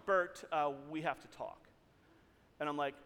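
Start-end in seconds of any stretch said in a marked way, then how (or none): 0.0-1.7 low, grave, quieter toward the end; solemn boss, voice sinking; very serious tone. Retold or imitated. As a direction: imitate a boss breaking serious news. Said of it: "in a very serious tone".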